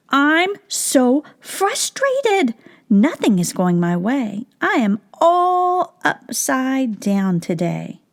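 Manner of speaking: high, slow, drawn-out mock frustration; frustrated turtle. Turtle complaining to Pete, hammed-up frustrated. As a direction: groan it out like a fed-up turtle. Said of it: "Turtle did not look happy".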